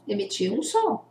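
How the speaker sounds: ordinary talk, nothing marked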